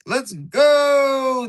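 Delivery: high, loud cheer; excited shout. Real, not acted. Streamer cheering a new country, loud. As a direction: yell it in triumph.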